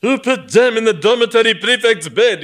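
loud, high roaring retort. Acted. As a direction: roar it back. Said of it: "he roar-, retorted".

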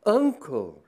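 loud, high startled cry; cry of surprise. Acted. Myrtle recognising her uncle, crying out in surprise. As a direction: call out in shock at the sight. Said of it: "uttered a cry of surprise".

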